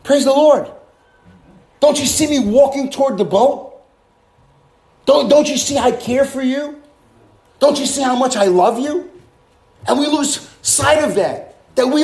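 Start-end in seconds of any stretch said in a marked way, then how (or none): 0.0-9.1 voicing Jesus. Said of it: "Jesus said".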